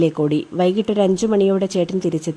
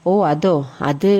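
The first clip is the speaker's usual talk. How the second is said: slow, low, halting reply; hesitant stalling; 5 semitones lower, slower than usual. Acted. Younger brother caught with cigarettes, hesitant and stalling.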